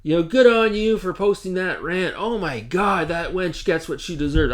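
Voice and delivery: high, lightly mocking put-on voice of a gloating woman; mocking imitation. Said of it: "And she's like".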